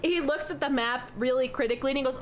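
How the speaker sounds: usual speaking voice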